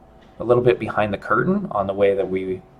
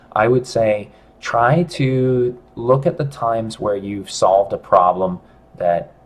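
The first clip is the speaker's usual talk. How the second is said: ordinary talk, nothing marked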